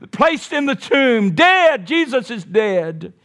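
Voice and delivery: high-pitched, driving sermon crescendo; impassioned pulpit delivery, stretching the last phrases; full-force preaching. Acted. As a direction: hammer out each phrase to a peak on "dead".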